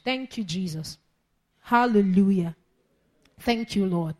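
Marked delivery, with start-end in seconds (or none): none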